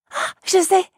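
high, bright eureka; sudden idea. Acted. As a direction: exclaim as the idea strikes.

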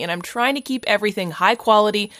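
normal talking voice

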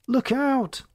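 sudden high-pitched warning snap; snapped warning. Acted. First mate warns the chief of a falling cat, high snap. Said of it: "Scott snapped".

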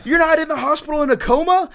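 high, loud squeal through laughter; a disbelieving shriek, half laughing; incredulous first sergeant. Retold or imitated. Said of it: "It's like".

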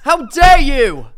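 slow, loud, high hammed-up indignation; mock outrage.

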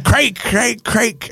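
high, insistent mock calling; mock insistent girl. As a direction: imitate her pleading for the song. Imitating the Russian girl.

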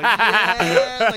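loud high laughing cheer; cheering yeah. Retold or imitated. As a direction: whoop like high-fiving buddies. Cheering in the theater.

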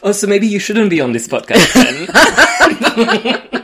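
amused, drawn-out, laughing jab; teasing laughter. Real, not acted. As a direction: tease the guest and crack up. Teasing guest, breaking into laughter.

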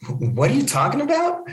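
high-pitched, acted disbelief; retold baffled exclamation; bewildered question. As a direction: ask it utterly lost, voice leaping up. Acted bewildered reply to Ben.

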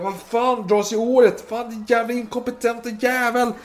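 high, loud furious outburst. Acted. Colonel de Surmain curses Silfverberg for inaction, furious shout. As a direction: rage at the useless commander. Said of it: "blev ju helt galen".

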